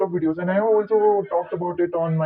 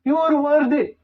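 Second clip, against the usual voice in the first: higher and louder, hyped-up guru; mock motivational YouTuber; motivational coach; 11 semitones higher, 4 dB louder than usual. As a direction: pump up the audience like a YouTube coach. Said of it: "so they say".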